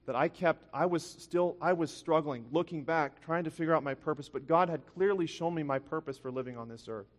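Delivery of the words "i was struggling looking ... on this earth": usual speaking voice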